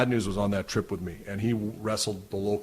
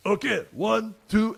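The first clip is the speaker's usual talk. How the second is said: high counting in a lightly French voice; old French veteran; 11 semitones higher, slower than usual. Retold or imitated. Veteran counting before cracking my neck, French voice. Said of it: "and he goes".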